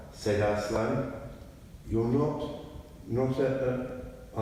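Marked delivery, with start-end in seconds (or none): 1.9-4.4 shaky voice. A narrator's reading. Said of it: "asked Susan in a shaky voice".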